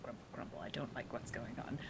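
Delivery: very soft, low muttering; grumbling. Acted. Game master voicing disgruntled crew, low grumble. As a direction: mutter it discontentedly under your breath. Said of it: "they're just sort of like".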